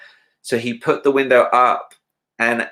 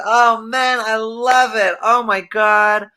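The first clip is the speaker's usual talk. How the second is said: Michael imitation; 10 semitones higher, 4 dB louder, slower than usual. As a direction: gush in a high airy voice, slowly. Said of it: "and he goes".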